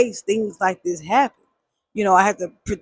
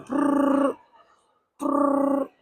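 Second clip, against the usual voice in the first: rolled ring sound; phone ring; 7 semitones higher, 4 dB quieter than usual. Retold or imitated. Mimicking the phone ringing, rolled brrr.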